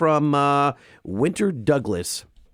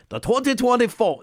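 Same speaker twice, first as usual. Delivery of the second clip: higher-pitched, tentative Australian accent; attempted Aussie accent; 6 semitones higher than usual. Shown as a demonstration. Host coaxed by his co-host into a short Aussie accent attempt. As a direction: try a poor Australian accent. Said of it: "give me some Aussie".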